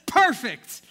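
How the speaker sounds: sharp loud shout of success; triumphant rooftop shout; loud satisfied call. Acted. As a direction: belt it out, job done. Roofers reach the right spot.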